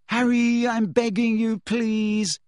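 high, drawn-out pleading; imploring, pitched well up; desperate. A narrator's reading. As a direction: beg desperately, stretching each phrase. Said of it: "said Hermione desperately".

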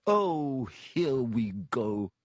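slow, sour resignation; bitter. Acted. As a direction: grumble it bitterly. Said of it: "said Nearly Headless Nick bitterly".

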